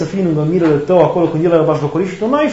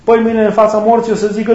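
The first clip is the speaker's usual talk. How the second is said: normal talking voice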